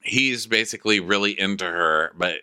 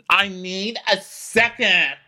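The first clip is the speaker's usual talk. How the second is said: high, drawn-out exasperated outburst; hammed-up plea to be left alone; acted exasperation; 12 semitones higher, slower than usual. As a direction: stretch each word in mock annoyance. Acted snapping in crew quarrel.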